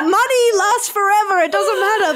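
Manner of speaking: high-pitched mock spendthrift; carefree spender. Parodies own carefree spending.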